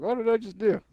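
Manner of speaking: high, stunned disbelief; stunned question. Retold or imitated. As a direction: ask it dazed at your own mistake. Retold regret after failing.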